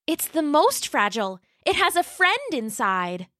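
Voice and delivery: put-on high, sweet little dinosaur girl voice; Roxy the young T-Rex. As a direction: play a polite, eager young T-Rex. Roxy explains friend in box.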